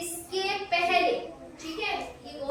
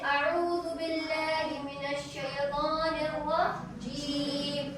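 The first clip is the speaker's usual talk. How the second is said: slow, drawn-out chanted recitation; Quranic recitation; 4 dB quieter, much slower than usual. Shown as a demonstration. Teacher demonstrating recitation to students, slow and chanted. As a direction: recite it slowly, like in prayer. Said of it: "किस तरीके से हम पढ़ते हैं".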